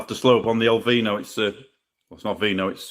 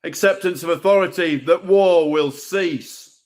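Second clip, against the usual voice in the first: raised, rhythmic poetry-slam delivery; spoken-word poem; 8 semitones higher than usual. Acted. Poet performing his piece to the open-mic crowd, declaiming. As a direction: declaim each line to the room.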